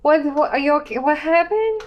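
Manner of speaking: flustered concern. Acted. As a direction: rush in asking what's wrong. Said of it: "kind of disheveled and like".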